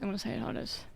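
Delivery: breathy whisper, like a secret confession; hushed under-the-breath admission; whispered aside. Real, not acted. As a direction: drop to a whisper for the blunt truth.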